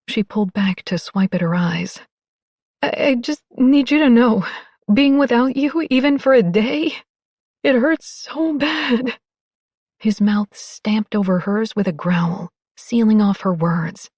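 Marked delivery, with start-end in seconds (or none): 2.8-9.2 higher, aching, slowing at the end; emotional woman voice. Acted. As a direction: plead it, voice rising with hurt. Jasmine pleading, higher voice.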